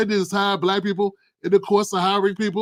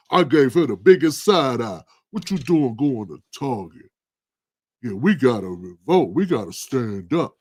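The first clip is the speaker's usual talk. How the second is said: chest-out commenter; 6 semitones lower than usual. Retold or imitated. Host mocking a commenter bragging about skipping Target, mild mock pride. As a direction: puff up like a self-satisfied commenter. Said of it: "people with the chest all out".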